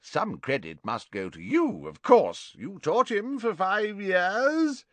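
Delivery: put-on high, hearty old professor voice; a fond, tipsy potions master; jovial Slughorn. Tipsy teacher praising Harry to Trelawney, high and hearty.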